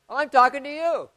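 brash woman. Retold or imitated. Brash patient demanding the pastor's attention, loud and high. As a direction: snap back like a pushy patient.

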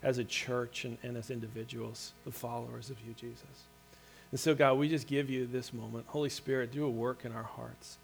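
soft, hushed prayer voice, low and reverent; closing prayer. Acted. Pastor praying softly over the congregation before the sermon, hushed. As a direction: pray quietly over the congregation.

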